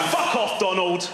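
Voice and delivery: acted, high-pitched furious snap; angry outburst. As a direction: play a fed-up parent lashing out. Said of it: "like a three-day angry walk".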